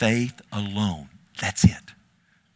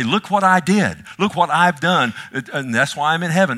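mock boastful; 6 semitones higher, 4 dB louder than usual. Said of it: "And you can say".

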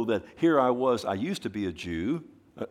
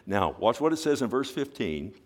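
normal talking voice

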